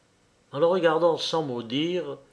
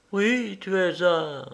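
slow, raised, deliberate statement; firm, slightly solemn; 5 semitones higher, slower than usual. A narrator's reading. Woman revealing what she knows, solemn. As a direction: state it slowly with some solemnity. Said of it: "d'un ton ferme et un peu solennel".

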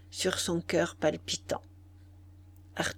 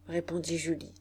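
ordinary delivery, nothing marked

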